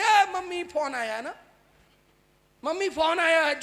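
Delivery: acted shout that mum has a phone call; daughter shouting for mother. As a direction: call out to mum from outside, loudly. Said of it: "जोर से बाहर खड़े होकर बोले".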